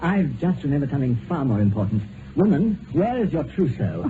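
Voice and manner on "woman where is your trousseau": mock stern. Teasing mock-stern question.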